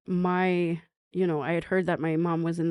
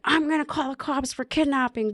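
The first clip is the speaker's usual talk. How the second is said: mock yell; 8 semitones higher than usual. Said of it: "my mom's yelling".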